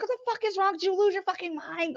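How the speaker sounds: very high, incredulous mock fury; losing his shit. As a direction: imitate someone flipping out, voice shooting up. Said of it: "lost his shit on me".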